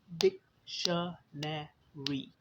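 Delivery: डिक्शनरी very slowly in four separate beats, डिक-श-ने-री; syllable by syllable. Shown as a demonstration. Teacher counting the parts of a word, डिक्शनरी syllable by syllable. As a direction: break डिक्शनरी into डिक, श, ने, री with pauses. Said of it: "चार हिस्से हो गए".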